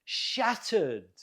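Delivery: SHAT stressed in Shattered, second syllable falling away; stress on first syllable. Shown as a demonstration. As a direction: model Shattered with the weight up front. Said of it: "stress on the first syllable".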